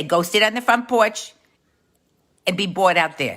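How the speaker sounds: put-on loud, high call to the kids; mother's order. Mother sends bored kids to the porch, loud and high.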